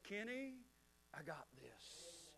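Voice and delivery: very soft, slow, low voice; sudden hush to comfort someone; hushed reassurance. Acted. God speaking into the confusion, hushed reassurance. God's calm answer amid the storm.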